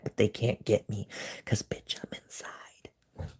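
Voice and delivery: hushed whisper, slowing down; whispering while hiding. Real, not acted. Coyotes outside, hiding indoors.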